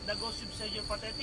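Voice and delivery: whispering. Acted. Eerie ghost tube remark.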